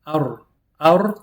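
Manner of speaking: Ar, Ar with a trilled R; rolled R. Shown as a demonstration. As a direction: roll the R in Ar. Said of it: "we get to roll our R's".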